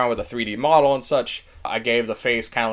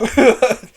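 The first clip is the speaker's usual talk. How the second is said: a burst of happy laughter; bright laugh; 10 semitones higher, 9 dB louder than usual. Real, not acted. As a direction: laugh out loud, delighted. Delight at the result.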